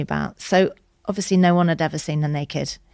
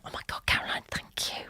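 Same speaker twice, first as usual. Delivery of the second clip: breathless whisper, much softer; whispered thanks; 11 dB quieter than usual. Retold or imitated. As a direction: whisper your thanks, overcome. Overwhelmed gratitude to sister.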